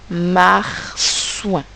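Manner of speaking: "marsouin" stretched into mar, then souin; slow dictation. Shown as a demonstration. Teacher dictating the word to write, "marsouin" slowly separated. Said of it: "vous allez tenter d'écrire le mot".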